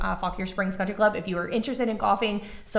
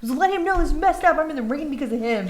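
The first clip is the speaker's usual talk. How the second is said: mock complaining son; 7 semitones higher, 4 dB louder than usual. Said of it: "And he was like".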